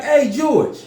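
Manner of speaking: high, loud call through a door. Retold or imitated. Storyteller calling George out of the bathroom, acted holler. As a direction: holler for a friend behind the door.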